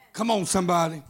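ordinary talk, nothing marked

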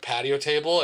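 normal talking voice

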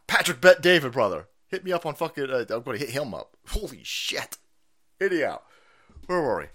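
normal talking voice

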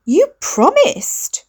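high, drawn-out wail of a promise; nearly breaking down, dragging it out; whiny plea. A narrator's reading. Sister pleads near breakdown.

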